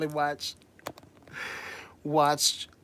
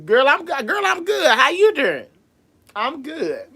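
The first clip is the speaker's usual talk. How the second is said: much higher, squealing greeting; gushing hello; 9 semitones higher, 4 dB louder than usual. Real, not acted. Drag host squealing back to a viewer. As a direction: squeal it out to a friend.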